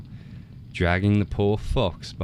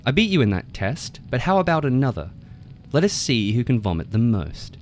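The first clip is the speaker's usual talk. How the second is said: higher, louder boastful frog voice; cocky frog; 5 semitones higher, 4 dB louder than usual. A narrator's reading. Frog brags to the tiger, higher and louder. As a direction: strut it out, pleased with yourself.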